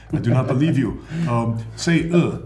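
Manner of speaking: mock French-accented doubter; accidental French accent. Estonians doubting a foreigner's language, put-on French accent. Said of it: "it comes out French".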